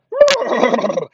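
loud, high imitated whinny; whinny like a charging steed; horse neigh.

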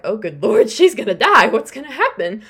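high, loud, half-laughing alarm; hammed-up worried outburst, voice jumping up; mock panic. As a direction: dramatize a reader's panic with a laugh. Acts out own alarmed reaction to reading.

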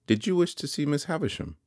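high, haughty question; proud young lady. A narrator's reading. Young lady stopping Pumblechook at the gate, haughty. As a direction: pitch it well up, coolly challenging.

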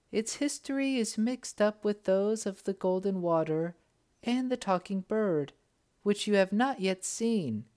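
put-on high, gentle young princess voice. A narrator's reading. Princess guiding the Sultan to the tree, high and gentle. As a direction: speak up lightly as the graceful princess.

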